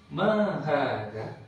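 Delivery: Mahada drawn out very slowly, each long vowel held; slow model reading. Shown as a demonstration. Teacher modelling Mahada slowly for the class. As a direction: stretch every syllable of Mahada slowly. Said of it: "jangan buru-buru".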